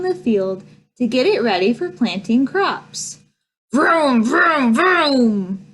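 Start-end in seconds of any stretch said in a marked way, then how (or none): 3.7-5.7 tractor impression. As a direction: rev it up like a tractor engine. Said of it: "[name]'s impression of a tractor".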